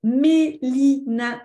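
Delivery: loud robotic syllable-by-syllable name; robot talk. Shown as a demonstration. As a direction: punch out each syllable separately like a robot. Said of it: "quand je coupe le mot de cette manière-là".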